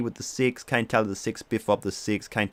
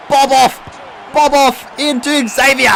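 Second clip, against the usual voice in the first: high, loud, yelled call; real excited yell, slowed; excited shout; 12 semitones higher, 6 dB louder, slower than usual. Real, not acted. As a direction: scream the play as it happens. Excited goal call in game.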